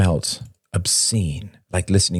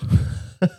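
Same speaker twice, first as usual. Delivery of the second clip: short voiceless cackle. A narrator's reading. Shane cackles at Derek's peacemaking, derisive cackle. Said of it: "Shane cackled".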